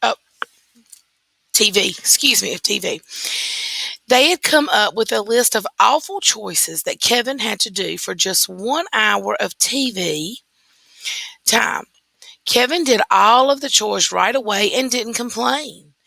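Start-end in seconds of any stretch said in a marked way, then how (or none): none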